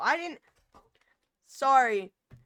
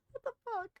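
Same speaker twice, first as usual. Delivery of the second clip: laughing disbelief. Real, not acted. Shocked by chat message.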